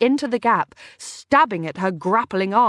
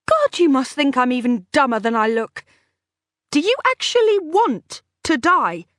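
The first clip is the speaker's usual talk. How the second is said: contemptuous; 6 semitones higher, 4 dB louder than usual. Acted. Captor sneering at prisoner.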